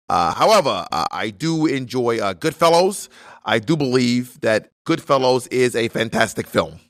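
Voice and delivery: halting senator impression with heavy accent; elderly-politician voice, stiff and emphatic; Bernie Sanders. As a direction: answer formally, with deliberate words and frequent uhs. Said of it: "I'm gonna do a voice".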